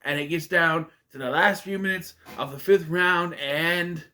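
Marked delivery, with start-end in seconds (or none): none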